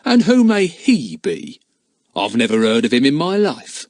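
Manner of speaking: lightly voiced jeering smuggler. A narrator's reading. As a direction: scoff as if never heard of him. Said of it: "with a jeering note in his voice".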